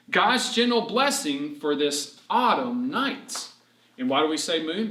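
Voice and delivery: ordinary talk, nothing marked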